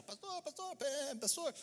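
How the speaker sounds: high, small, hushed pretend yell; mock sheep's cry. Sheep calls the shepherd when the wolf appears, mock cry, high and soft. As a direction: imitate a little sheep calling for help, softly. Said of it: "Começa a gritar".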